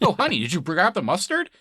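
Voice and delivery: high, chirpy mock husband; goofy husband voice. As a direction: call to his wife in a silly high voice. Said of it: "some guy in his greenhouse".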